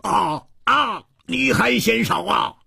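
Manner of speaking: very high-pitched indignant cry; furious outburst. A narrator's reading. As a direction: explode in outraged disbelief. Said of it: "胡铁花大怒". (大怒，尖声高喊)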